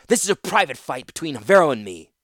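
put-on loud, strained young fighter's voice; defiant student. Torsten shouting defiance at Gerard. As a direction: push him back, refusing to yield. Student defies master with drawn blade.

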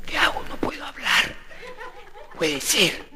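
hoarse near-whisper, mostly soundless; voiceless priest. Acted. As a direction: croak it with no voice left. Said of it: "no le salía la voz". (susurro ronco de cura afónico)

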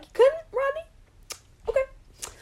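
high, mocking disbelief; sarcastic retort. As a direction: needle Rodney with fake surprise. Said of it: "And I'm like".

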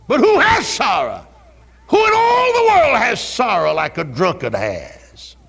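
high, loud shouted preaching; preaching at full force. Acted. Preacher warning his church about drunkards, shouting. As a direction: cry it out over the congregation.

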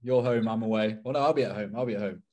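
ordinary talk

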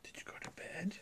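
hushed unvoiced question; whispering. Real, not acted. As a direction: echo the whisper back softly. Parent answers child's whisper.

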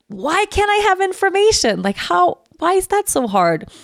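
high, frantic why; exasperated. Retold or imitated. Venting on the phone with customs, frantic and high. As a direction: plead in disbelief.

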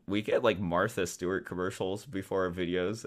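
ordinary talk

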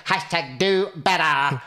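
slow, hammed-up preachy slogan; mock slogan. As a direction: drawl the hashtag like an online scold. Mocking a social media slogan.

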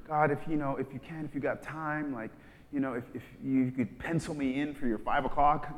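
hedging, offhand; mock casual prayer. Believer lazily asking God, offhand. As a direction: treat God like a busy clerk. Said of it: "It's no longer".

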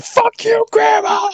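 high, screechy pretend shout; mock angry yell. Storyteller replaying his road rage, mock angry yell. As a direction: holler a hammed-up insult out the window. Acted yelling at a grandma.

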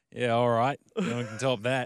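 put-on cocky Kiwi driver in a New Zealand accent. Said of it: "in his New Zealand accent".

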